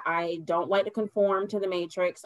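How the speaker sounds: ordinary delivery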